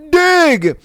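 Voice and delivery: high, drawn-out booming call; booming yell. Acted. Unseen voice calls to the man again, booming yell. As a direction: command it like a voice from above. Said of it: "he hears".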